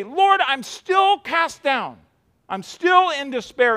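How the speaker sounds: slow, high pleading lament. Retold or imitated. Believer crying to the Lord in despair, pleading. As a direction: plead from inside the struggle. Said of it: "and you say".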